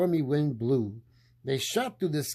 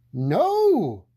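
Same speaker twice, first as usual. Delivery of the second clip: frightened cry; 6 semitones higher, 8 dB louder than usual. Acted. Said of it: "cried the witch".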